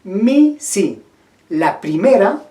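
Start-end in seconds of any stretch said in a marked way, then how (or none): 0.0-1.0 two separate words, "Mi" up and "si" down; closed versus opener i. Shown as a demonstration. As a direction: set "Mi" against "si" to compare their i vowels. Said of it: "Cuál es más cerrada y cuál es ligeramente más abierta".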